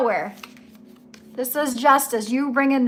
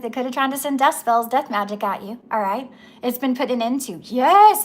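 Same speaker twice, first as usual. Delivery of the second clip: ordinary delivery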